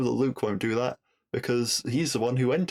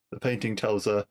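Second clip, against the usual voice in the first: ordinary talk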